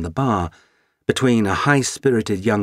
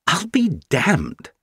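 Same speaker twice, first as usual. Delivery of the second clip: surprised Brit; 10 semitones higher, slower than usual. Acted. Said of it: "said the Brit".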